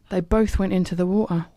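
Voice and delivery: ordinary delivery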